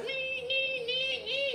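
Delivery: high squeaky nee-nee noises, held. Retold or imitated. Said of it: "it's just like".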